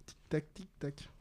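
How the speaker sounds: soft, slow tick-tock; ticking clock imitation. Quizmaster waits for answers, softly ticking. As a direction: softly mimic a clock counting down.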